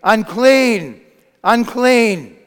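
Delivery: loud, high, falling cry; shouted warning. Acted. Leper warning passers-by on his way out, shouting. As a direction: call it out to warn everyone off. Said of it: "he had to shout".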